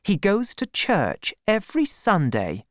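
high, indignant insistence; angry. A narrator's reading. Woman vouching for Troy to Gabriel, mildly angry. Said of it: "said Bathsheba angrily".

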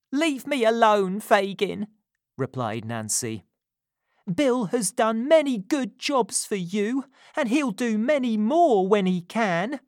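put-on defiant young woman. A narrator's reading. Nancy defending Bill.

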